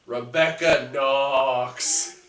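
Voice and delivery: loud, drawn-out ring-name announcement; dramatic name reveal. Acted. Co-host revealing Becky's old wrestling name, loud and theatrical. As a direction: belt it out like a big reveal.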